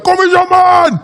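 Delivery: put-on high, loud Afrikaans-accented yell; giant Afrikaner's shout. Huge young Afrikaans guy summoning him, insistent shout. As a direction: order him over at full voice. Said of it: "He's like".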